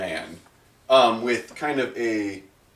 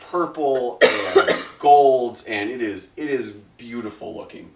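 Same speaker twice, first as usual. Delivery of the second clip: ordinary talk, nothing marked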